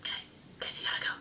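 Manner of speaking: hushed, breathy warning; a store worker whispering that they must leave. Retold or imitated. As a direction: whisper it urgently to the group. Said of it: "He's like".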